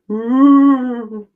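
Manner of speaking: mock ghost wail floating off; ghostly ooh. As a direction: let it drift away like a ghost. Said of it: "as he floats off going".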